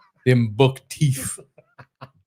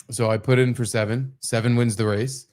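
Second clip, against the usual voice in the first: ordinary talk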